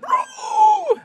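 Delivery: very high, loud goblin scream. Retold or imitated. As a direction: scream like a goblin falling off a cliff.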